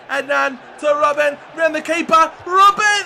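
rising from loud calls to a high yell; real excitement growing to a yell; building excited shout. Real, not acted. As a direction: build the shout as he rounds the keeper. Building attack, shouting players.

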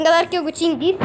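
usual speaking voice

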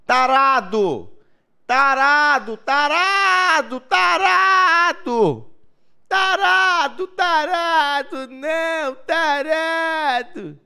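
high, slow, stretched mock shouting; drawn-out yell. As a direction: stretch each word out in a hammy yell. (gritando arrastado, zoeira)

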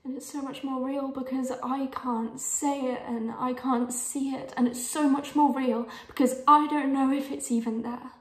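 mounting, pleading intensity; impassioned confession. Acted. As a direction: build steadily from firm to loud and insistent. Impassioned confession, repeated and building.

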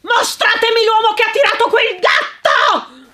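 loud, high thundering demand; booming furious command. Acted. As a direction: thunder it across the garden. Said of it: "tuonò".

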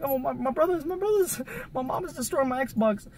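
high, stammering retold plea; mock panic. Son telling friends mom is destroying Xbox, mock panicked high voice. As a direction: break bad news to teammates in hammed-up distress. Retells frantic reply to friends.

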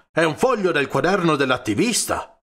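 outraged discovery; exclaiming. A narrator's reading. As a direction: blurt it out in shock. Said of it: "esclamò".

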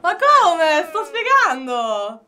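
indignant protest. Real, not acted. Protests being mocked.

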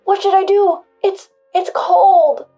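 loud, high, rising panic; trembling, panicked. Acted. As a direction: whine it out in distress. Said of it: "confusion and trembling voice".

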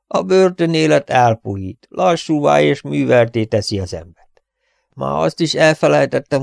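a lightly voiced wheezing old actor; wheezy hoarse old man. Freezing old Barker complaining to Robin, lightly wheezy voice. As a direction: groan it out, worn and frozen. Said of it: "sipoló, reket hangon beszélt".

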